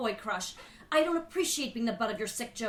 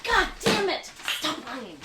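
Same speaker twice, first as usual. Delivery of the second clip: sharp higher angry outburst; angry denial; 4 semitones higher than usual. Acted. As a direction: snap at him to stop lying. Teacher angrily denies affair.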